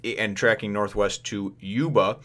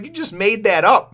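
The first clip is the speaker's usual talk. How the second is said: higher, louder incredulous scoff; mock scoff; 11 semitones higher, 8 dB louder than usual. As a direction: call his bluff. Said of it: "She's like".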